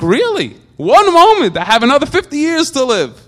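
mock disbelief. A listener scoffing at the Mishnah's teaching, mock disbelief. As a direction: scoff at the idea with a high incredulous lift. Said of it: "And like".

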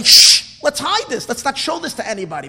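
loud shush, then high urgent plea; hushing follower. Acted. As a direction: act out hushing everyone to hide it. Acts embarrassed follower hushing others.

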